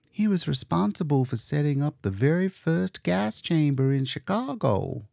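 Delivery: a touch of starry-eyed pride; Leora admiring. Acted.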